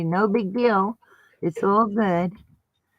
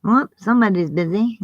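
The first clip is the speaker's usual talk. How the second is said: usual speaking voice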